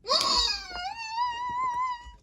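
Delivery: very high shrieking gasp; shriek-gasp in delight; high squealing gasp. Real, not acted. Big pull reveal.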